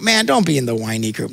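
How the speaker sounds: normal talking voice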